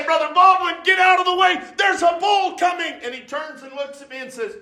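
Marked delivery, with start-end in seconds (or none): 0.0-2.9 hollering a warning. Retold or imitated. Preacher yelling to Brother Baldwin to get off the road, urgent shout. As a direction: call out to a man standing in the road. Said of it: "I holler at him".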